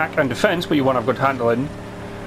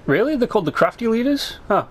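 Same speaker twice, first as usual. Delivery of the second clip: surprised; 7 semitones higher, faster than usual. Real, not acted. Surprised by in-game text.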